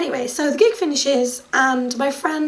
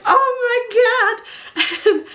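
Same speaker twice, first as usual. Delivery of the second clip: thrilled exclaim; 9 semitones higher, slower than usual. Retold or imitated. Fan exclaiming as she is dragged to the drummer. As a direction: exclaim it with fangirl glee.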